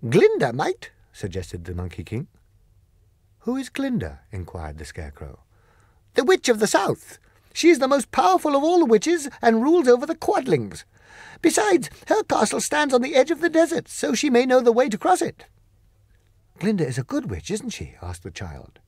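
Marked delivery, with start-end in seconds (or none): 0.1-0.9 high, loud creature voice; offering a hopeful answer; Monkey King. Acted. 3.4-4.2 high curious question; wondering aloud; lightly voiced curious Scarecrow. Scarecrow asks. 6.1-15.5 high, loud creature voice, firm; polite but final refusal; Monkey King declining, high and loud; Monkey King refusing. Acted. 16.6-18.0 higher, hopeful question; a child checking for reassurance; lightly voiced hopeful girl; girl's voice. Child asks.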